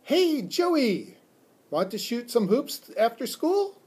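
high, bright-eyed friendly call to a pal; eager, high-pitched friendly invitation; friendly, smiling. A narrator's reading. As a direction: invite a friend out to play with a big smile. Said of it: "big, friendly smile and said".

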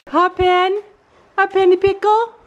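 high sing-song voice to the pony; pet talk. Real, not acted. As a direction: coax the horse in a high cutesy voice. Talking to horse, pet voice.

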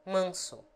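"Manso" with a nasal first a. Shown as a demonstration. Tutor demonstrates the nasal a. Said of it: "A nasal".

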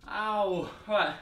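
usual speaking voice

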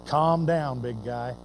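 low, soft, gentle soothing; Jesus calming Peter, low and quiet. Retold or imitated. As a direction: settle a hothead down gently. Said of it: "the Lord just kinda looked at him".

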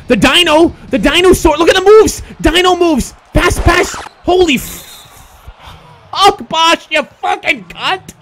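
high, loud hype turning to drawn-out cursing; high-pitched frantic gamer outburst; excited yelling. Real, not acted. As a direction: get worked up, then curse the miss slowly. Excited, then cursing a miss.

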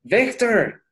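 put-on an older cousin calling in American style; English accent. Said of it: "el hermanito o el primo que ya habla el inglés".

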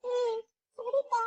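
put-on frightened little girl agreeing through tears; tearful whimper. Little Pinky scared, crying.

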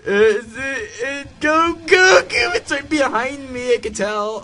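very high, loud, frantic; panicked screaming. Real, not acted. Player chased from right behind, panicked screaming. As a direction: scream in terror with the threat close.

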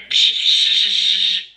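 high loud burst of laughter. Real, not acted. Laughing after teasing the officer, loud high laugh. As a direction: laugh at his own joke.

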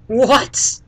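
loud, high startled "What?"; surprised what. Real, not acted. Reacting to a notice about abandoned babies, startled and loud. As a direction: blurt it out in disbelief.

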